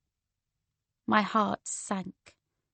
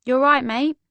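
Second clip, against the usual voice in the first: higher, louder casual London voice; London accent; 4 semitones higher, 6 dB louder than usual. A narrator's reading. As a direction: greet them like a London local. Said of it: "in a typically London voice".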